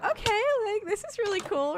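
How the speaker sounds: excited. Retold or imitated. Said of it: "and I was like".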